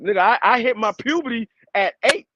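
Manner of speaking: ordinary talk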